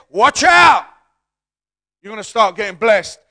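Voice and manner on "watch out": loud, high warning shout. Acted. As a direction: shout it to the crowd.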